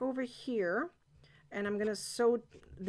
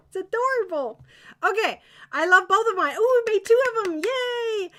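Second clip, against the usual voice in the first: high, loud and bubbly; delighted; 9 semitones higher, 9 dB louder than usual. Real, not acted. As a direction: cheer over the finished pair. Delighted at two finished dolls.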